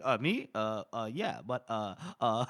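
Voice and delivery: put-on nervous dithering; flustered stammer. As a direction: fumble for an answer, caught off guard. Acting out a flustered subordinate.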